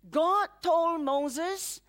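ordinary delivery

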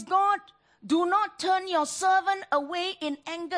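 a cry of someone afraid of God's anger; loud anguished plea. Acted. Preacher voicing the psalmist begging God, loud anguished plea.

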